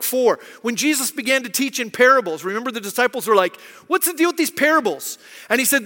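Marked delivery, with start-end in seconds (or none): 3.9-5.2 higher, louder baffled question; mock puzzled complaint. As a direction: play a confused pupil griping. Acts out the puzzled disciples.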